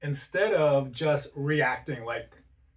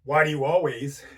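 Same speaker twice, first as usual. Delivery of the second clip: loud, exaggerated angry demo; hammed-up judging reproach; accusing tone; 7 dB louder than usual. Shown as a demonstration. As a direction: fire it off as an accusation. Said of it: "we may not be saying".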